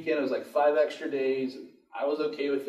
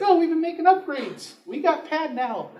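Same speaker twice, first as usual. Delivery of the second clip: high-pitched, louder mock voice; hammed-up high mimicry; mocking impression; 13 semitones higher, 5 dB louder than usual. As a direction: put on a silly high voice, imitating someone. Mock voice for the property.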